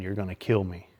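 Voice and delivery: normal talking voice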